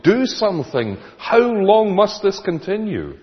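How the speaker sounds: high, loud cry to God; prophet's lament. Retold or imitated. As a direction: call out in rising despair like Habakkuk. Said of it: "like the cry of Habakkuk".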